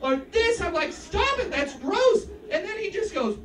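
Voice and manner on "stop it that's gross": high-pitched teacher's protest; disgusted scolding. Acted. Teacher scolds finger-sucking student, disgusted. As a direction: tell him off, pitched up in disgust.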